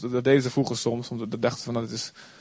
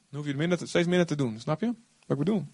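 normal talking voice

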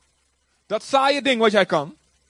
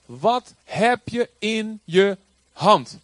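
very slow, weighty question; voice of God; 4 dB louder, much slower than usual. Retold or imitated. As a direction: let God ask it slowly and deliberately. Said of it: "zegt God". (God spreekt langzaam en plechtig tot Mozes)

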